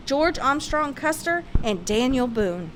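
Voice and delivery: ordinary delivery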